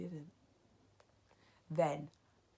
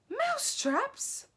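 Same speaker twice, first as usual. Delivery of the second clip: high, slow, stretched question; baffled crowd of witches crying out; shrill puzzled echo; 6 dB louder, slower than usual. A narrator's reading. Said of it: "cried the witches".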